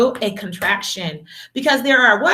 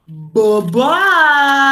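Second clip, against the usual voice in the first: sing-song bye-bye; magic goodbye; 5 semitones higher, 5 dB louder than usual. Shown as a demonstration. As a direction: wave them off cheerfully. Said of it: "Say it with me".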